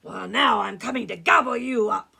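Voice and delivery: very loud troll threat; roaring troll. Acted. Troll threatens the biggest goat, roaring. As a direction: bellow it like a hungry troll. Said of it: "roared the troll".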